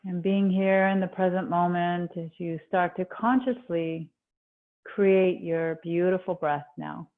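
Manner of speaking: calm meditation voice, long pauses between phrases; guided meditation. Acted. Yoga teacher easing students into breath awareness, guided meditation. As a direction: settle them gently inward, leaving space after each instruction.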